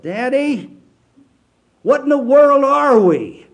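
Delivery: put-on high, loud, worried child's voice; a small daughter worried she's lost who she is, asks her father; upset little girl. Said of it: "She was really upset".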